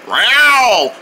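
drawn-out warning yowl; cat meow. Acted. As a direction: yowl at the intruders.

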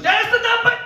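high, loud triumphant shout; grandma crying out the cure, high and loud. Retold or imitated. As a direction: yell out the answer. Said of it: "my grandmother just kicks it".

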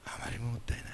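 hushed whisper of あまりにももったいない; whispered repeat. Shown as a demonstration.

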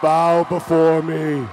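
slow, loud, commanding villain decree; dark lord. Acted. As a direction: boom it out like an evil overlord. Villain guac commands the crowd.

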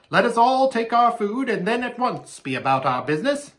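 high bird character voice; raven voice. A narrator's reading. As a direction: counsel the king as a raven. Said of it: "said the raven".

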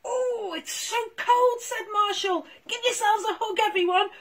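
a cold little dog talking; puppy Marshall. Acted. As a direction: Marshall shivering in the snow. Voices Marshall the pup.

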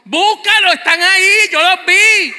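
loud, high insistent call across the house; mother yelling back. Retold or imitated. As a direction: shout back like an impatient mom. Madre insiste a gritos. (madre gritando impaciente)

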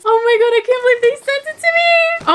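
real thrilled outburst; excited squeal. Real, not acted. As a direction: shriek with disbelieving joy. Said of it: "gonna get a noise complaint".

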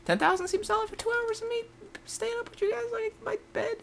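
very high squeaky begging; mock-pitiful plea. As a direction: squeak it out like a fake sob story.

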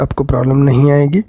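ordinary talk, nothing marked

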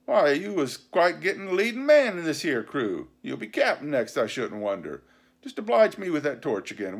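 lively, swooping sardonic pirate; mocking pirate leader. A narrator's reading. Silver to his challenger George, mockingly pleasant. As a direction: flatter him with obvious mockery.

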